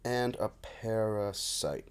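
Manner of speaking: slow, soft word-by-word reading; absent, drawn-out muttered query; typing aloud. Real, not acted. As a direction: say each word slowly as you type it.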